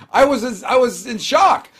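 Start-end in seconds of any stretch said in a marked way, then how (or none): none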